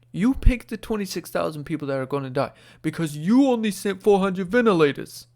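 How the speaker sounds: bad New York accent. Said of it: "that's the worst New York accent you've ever heard".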